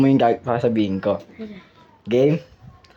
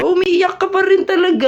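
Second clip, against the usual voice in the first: mock exasperation. Mock scolding of challenge player.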